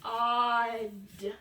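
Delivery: "r-odd" split into "r" and "odd", slow and low; sounding out. Shown as a demonstration. Teacher segmenting the word rod while writing. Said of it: "So I'm gonna write that word".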